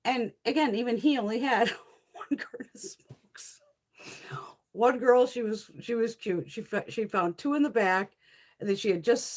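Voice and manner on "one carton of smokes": breathy laughter through the words; laughing. Real, not acted. Storyteller cracking up over the lone carton, laughing. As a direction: lose the line to real laughter.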